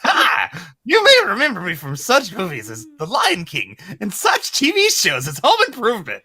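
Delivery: high, cheery celebrity intro; hammed-up child star voice; Jonathan Taylor Thomas voice. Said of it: "my Jonathan Taylor Thomas voice".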